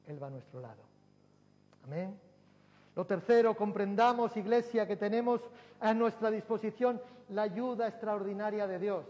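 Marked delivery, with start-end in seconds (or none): 2.9-9.0 loud, high, ringing sermon delivery; fiery pulpit preaching; full-force preaching. Acted. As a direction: preach it out to the whole church.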